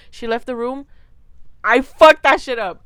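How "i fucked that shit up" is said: loud, gleeful declaration; loud boast. Real, not acted. Telling viewers she wolfed the sandwich, loudly and gleefully. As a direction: punch it out proudly. Bragging about devouring the sandwich.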